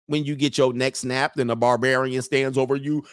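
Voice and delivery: ordinary talk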